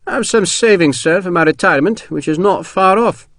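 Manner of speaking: high-pitched voice of a careful Scotsman; thrifty old soldier, pitched well above the narration; reserved Scottish colonel. A narrator's reading.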